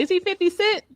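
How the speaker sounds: high, squeaky retold panic; high-pitched mock bewilderment. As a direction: pitch way up like a confused onlooker. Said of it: "I was like".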